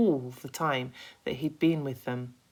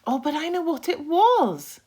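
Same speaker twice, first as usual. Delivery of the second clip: much higher, louder eager cry; excited cry; 10 dB louder than usual. Acted. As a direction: jump in excitedly. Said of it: "cried James".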